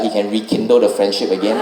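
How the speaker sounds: normal talking voice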